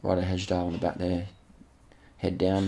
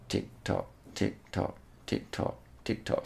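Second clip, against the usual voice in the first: soft, evenly spaced beats; rhythmic ticking; 9 dB quieter than usual. Shown as a demonstration. Commentator mimics the climber's sway, soft rhythmic ticking. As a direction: tick it out quietly like a clock. Voicing rhythm of swaying saddle.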